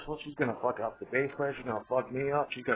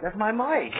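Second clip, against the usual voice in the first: mock protest; 9 semitones higher, 6 dB louder, slower than usual. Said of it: "Bass player's like".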